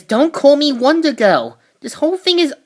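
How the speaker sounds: spoiling for a fight; fired-up heroine. Retold or imitated.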